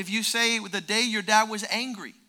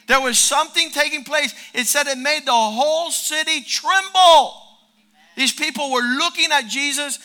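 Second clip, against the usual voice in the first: building sermon fervor; 6 semitones higher, 8 dB louder than usual. Acted. Preacher building excitement about the city's celebration. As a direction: preach it up to a climax.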